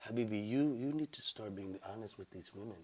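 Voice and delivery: low, hushed, gentle delivery; soft-spoken woman. Retold or imitated. Woman gently advising Habibi about honesty, real soft. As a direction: say it very softly and low. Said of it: "Real soft".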